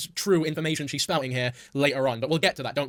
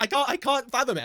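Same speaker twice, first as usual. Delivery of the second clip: ordinary delivery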